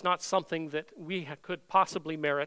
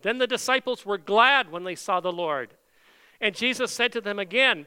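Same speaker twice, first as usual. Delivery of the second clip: fervent preaching; 2 semitones higher, 6 dB louder than usual. Acted. Pastor preaching Jesus's words to the disciples, fervent and loud. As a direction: preach it out to the congregation. Preacher lifts the scripture reading.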